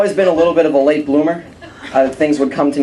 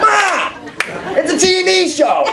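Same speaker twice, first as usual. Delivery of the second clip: Boston son; slower than usual. Retold or imitated. Son yelling back at his mother in a thick Boston accent. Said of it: "real thick Boston accent".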